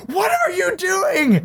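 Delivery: high, slow, stunned what-are-you-doing; incredulous confrontation. Retold or imitated. Confronting the guy face to face.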